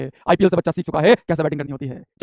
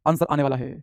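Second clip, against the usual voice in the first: lower, softer repeat; low aside; 5 semitones lower, 6 dB quieter than usual. Real, not acted. Teacher waiting for students' answers, low soft aside. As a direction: drop it down almost to yourself. Same words, dropped back.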